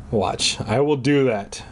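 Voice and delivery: usual speaking voice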